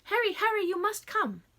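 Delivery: calling out high and loud; urgent girl's call from the yard; excited shout. A narrator's reading. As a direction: shout for Harry across the yard. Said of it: "a great shout erupted from the yard".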